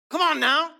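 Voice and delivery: loud, high preacher's call; a sudden preacher's shout; rousing call. Real, not acted. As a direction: rouse the room. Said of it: "preacher's rousing call".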